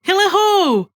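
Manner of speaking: hearty Fezziwig cheer; cried out. A narrator's reading. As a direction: sing it out merrily. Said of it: "cried old Fezziwig".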